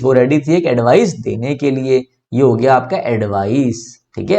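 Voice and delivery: usual speaking voice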